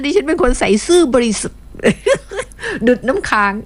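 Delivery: reading a listener's boast, breaking into giggles; laughing while reading. Retold or imitated. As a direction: giggle through the words. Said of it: "เขียนว่าแบบเนี้ย ดิฉันอ่านตาม".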